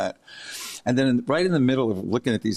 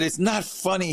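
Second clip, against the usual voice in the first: higher, offended retort; mock indignant; 9 semitones higher than usual. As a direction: play her as stung and indignant. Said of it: "She said".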